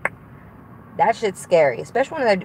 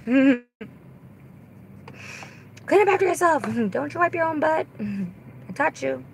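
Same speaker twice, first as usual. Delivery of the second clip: high, laughing telling-off; mock scolding mom; 7 semitones higher than usual. Mom telling kids to clean up, mock scolding.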